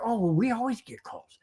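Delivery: mock whiny Chiefs. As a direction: whine it like a spoiled team. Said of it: "'Cause they're like".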